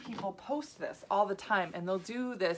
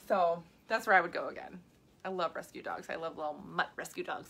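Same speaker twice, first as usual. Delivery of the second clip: ordinary talk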